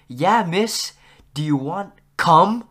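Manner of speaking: too loud. Retold or imitated. Class clown quipping for nearby desks, too loud. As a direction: blurt it out too loudly, stretching the last words. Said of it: "I said it a little too loud".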